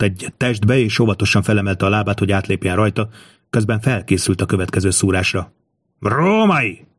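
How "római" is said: high, drawn-out warning cry; narrated shout, raised in pitch more than volume; calling out. A narrator's reading. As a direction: call out across a battle, pitch swooping up. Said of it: "kiáltotta Bedriákusz".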